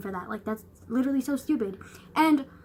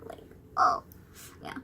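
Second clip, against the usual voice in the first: ordinary talk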